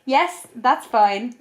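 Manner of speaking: slow, stiff fake politeness; mock-cheerful answer to the masseuse; forced polite. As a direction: drag it out politely through discomfort. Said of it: "I was like".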